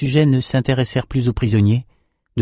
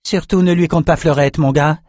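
a lightly raised, wry voice; light character voice; 7 semitones higher than usual. Acted. Lila advises the young swordsman, lightly voiced. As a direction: toss the advice off with a higher tone.

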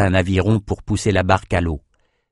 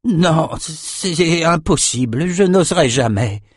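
put-on slow, choked old man's refusal, higher; terrified refusal; 7 semitones higher, slower than usual. A narrator's reading. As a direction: refuse out of sheer terror, slowly. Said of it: "faillit s'étrangler de terreur".

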